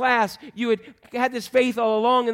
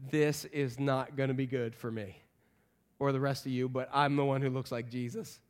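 low, soft, resigned grumble; dry sarcasm; 7 semitones lower, 8 dB quieter than usual. Acted. Thomas to the rest of the disciples, glumly sarcastic. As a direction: keep it low and dry, unenthusiastic. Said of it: "He's so sarcastic".

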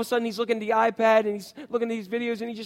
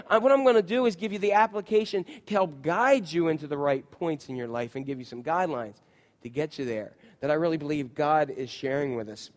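ordinary delivery

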